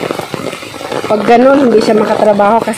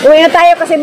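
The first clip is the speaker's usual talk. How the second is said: calling out; 9 semitones higher, 3 dB quieter than usual. Real, not acted. Calling to boy in rain.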